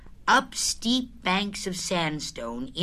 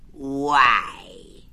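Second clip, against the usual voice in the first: a slow, mournful sigh of a question; sad; 3 semitones lower, 5 dB louder than usual. A narrator's reading. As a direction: ask it as a lonely donkey lost in thought. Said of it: "he thought sadly to himself".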